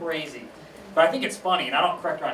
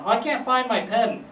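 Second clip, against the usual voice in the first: high-pitched grandma voice; imitated grandmother; 6 semitones higher than usual. Grandmother who has lost her pen, high imitated voice. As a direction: play grandma, pitched well up. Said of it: "my grandma".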